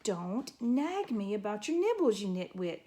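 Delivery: ordinary delivery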